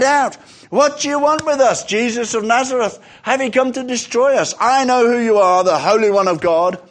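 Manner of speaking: high, loud, urgent challenge; crying out. A narrator's reading. Possessed man confronting Jesus in the synagogue, crying out. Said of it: "possessed by an evil spirit cried".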